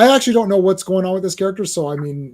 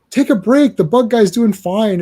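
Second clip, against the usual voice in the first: loud, high taunting voice; loud and high, slowing on the second line; mocking hero voice; 6 semitones higher, 8 dB louder than usual. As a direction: tease the hero grandly.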